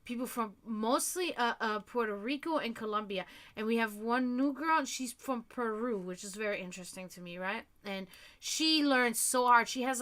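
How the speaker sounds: usual speaking voice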